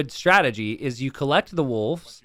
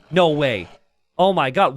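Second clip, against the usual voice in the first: usual speaking voice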